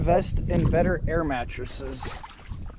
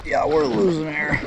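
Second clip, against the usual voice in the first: ordinary talk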